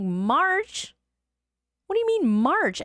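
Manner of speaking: high, loud disbelieving outburst; can't believe what she just heard; wife shocked at husband's timeline, high and loud; incredulous. Retold or imitated. Shocked at waiting until March.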